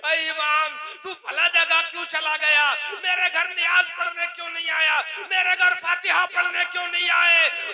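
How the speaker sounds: very loud, high acted demands; retold shouted telling-off, mounting question by question; scolding worshipper. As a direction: bellow at the imam as an accusing congregant. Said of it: "इमाम को डांटते हुए".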